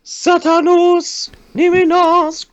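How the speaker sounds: very high, slow chanting ritual voice; mock incantation. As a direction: intone it like a hammy cultist.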